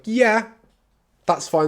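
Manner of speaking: yeah pitched high, a pause, then fine; hesitant yes. Retold or imitated. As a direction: let the doubt crack through the yes. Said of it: "Sometimes the cracks show".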